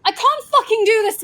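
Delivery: yelling out in real frustration; frustrated shout. Real, not acted. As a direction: blurt it out at full volume. Frustrated player outburst.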